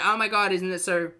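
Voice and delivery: mock gushing. Voicing the woman's gushing reaction.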